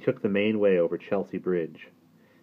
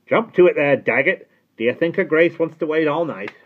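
high, loud impatient coachman; loud impatient call; impatient shout; 10 semitones higher, 10 dB louder than usual. A narrator's reading. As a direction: yell at the gatekeeper to hurry. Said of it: "the coachman was crying impatiently".

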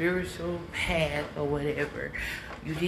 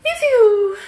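high, loud exclamation; sudden shout; 11 dB louder than usual. Acted. To viewers, a sudden loud call. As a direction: burst out loud.

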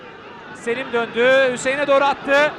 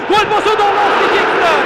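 excited commentator call; 7 semitones higher, 5 dB louder than usual. Real, not acted. Chance at goal, rising excitement.

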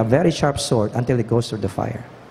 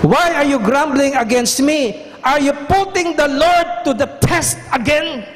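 high, earnest plea; pleading Moses; 11 semitones higher than usual. Retold or imitated. Moses rebuking people.

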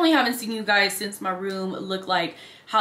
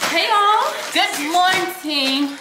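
much higher, louder, drawn-out hello; sing-song high greeting; 9 semitones higher, 5 dB louder, much slower than usual. Pretend. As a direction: chirp a bright, stretched good morning. Playful high greeting opening vlog.

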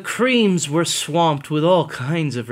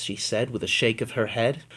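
ordinary delivery

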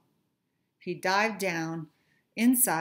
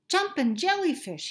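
high squeaky fish exclamation; a small fish's dismayed cry, lightly done; mild groan; 9 semitones higher, 4 dB louder than usual. Acted. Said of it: "he groaned".

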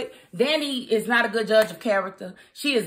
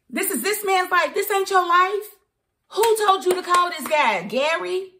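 indignant outburst; 9 semitones higher than usual. Real, not acted. Indignant rant at the lawyer.

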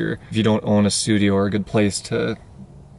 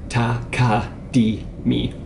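"takadimi" slowly, in four separate syllables; much slower than usual. Shown as a demonstration. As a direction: spell out ta, ka, di, mi with gaps. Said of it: "Think of the phrase takadimi".

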